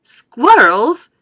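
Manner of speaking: loud excited yell; shout. A narrator's reading. Veruca spots the squirrels, shouting loud. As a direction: belt it out in delight. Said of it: "shouted Veruca Salt".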